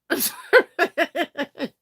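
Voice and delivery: slow apology dissolving into a laugh. Real, not acted. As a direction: laugh out a sheepish sorry.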